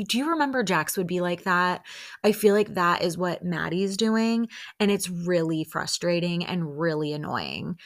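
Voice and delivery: ordinary talk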